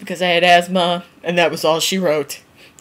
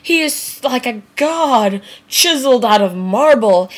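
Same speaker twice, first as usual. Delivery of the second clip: higher, dreamy gushing; swooning fan; 4 semitones higher, slower than usual. Retold or imitated. Fan swooning over a teen star, dreamy gushing. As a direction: gush like an awestruck fan. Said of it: "you're like".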